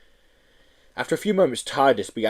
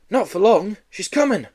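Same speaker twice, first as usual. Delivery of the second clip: urgent cry; 11 semitones higher, 3 dB louder than usual. Acted. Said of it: "cried Harry Skinner".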